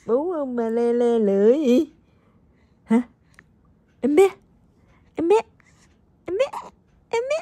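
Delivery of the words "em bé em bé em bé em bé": short, very high repeated calls; playful baby calls. Pretend. Mother playing with her baby to make it laugh, high playful calls. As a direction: tease the baby with little high-pitched calls.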